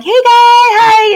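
high, bright, loud hello to break the ice; chirpy greeting. Retold or imitated. Walking into the audition room, greeting the director with a chirpy, high hello. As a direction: sing out a too-cheerful hi.

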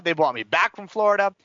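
usual speaking voice

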